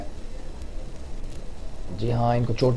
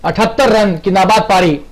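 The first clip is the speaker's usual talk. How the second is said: excited commentary; 6 semitones higher than usual. Real, not acted. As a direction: shout the six with joy. Sixes rain, commentator elated. (जोशीली commentary)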